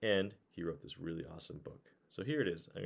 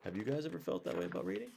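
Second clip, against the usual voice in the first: usual speaking voice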